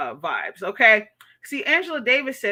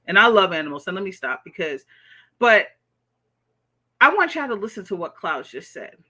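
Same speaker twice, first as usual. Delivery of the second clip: ordinary talk, nothing marked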